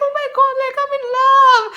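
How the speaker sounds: high, swooning mock squeal; hammed-up infatuated cry; lovestruck gush. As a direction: gush it high, head over heels. Retells her lovestruck reaction.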